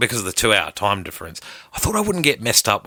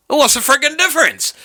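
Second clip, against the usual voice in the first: much higher, louder exasperated cry; exasperated high outburst; 5 dB louder than usual. Retold or imitated. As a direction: throw up your hands at the fuss. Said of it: "And I'm like".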